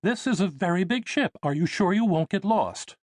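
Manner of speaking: very high, motherly and cautioning; fussy mother. Acted. Mother halting her son before he explores, high and worried. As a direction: stop him with a mother's worry.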